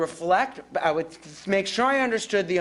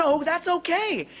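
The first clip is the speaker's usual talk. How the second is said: normal talking voice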